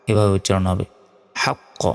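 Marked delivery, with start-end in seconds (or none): 1.4-1.8 হাক্ক with a push on the final q; qalqalah. Shown as a demonstration. Teacher showing the end push, হাক্ক with bounced q. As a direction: stop on হাক্ক and let the q bounce. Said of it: "শেষে একটা ধাক্কা দিতে হবে।".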